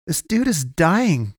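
high, drawn-out protest. A narrator's reading. Boy pleading for the wounded man, protesting. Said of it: "Kalen protested".

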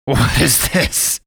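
mock puzzlement from a man who isn't scared; wondering. A narrator's reading. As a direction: fat priest amused at the girl with a gun. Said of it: "he wondered".

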